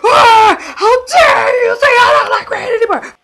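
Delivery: fake-sob it out like a wounded fan; mock crying outburst. Showing viewers her first reaction, hammed-up sobbing wail. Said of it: "I'm gonna show you guys how I was feeling".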